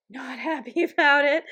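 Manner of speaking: laughing through the words; a laugh breaking in mid-line. Real, not acted. Laughs at returning to work.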